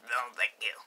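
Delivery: whispered put-on friend voice; whispered refusal. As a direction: whisper it dismissively.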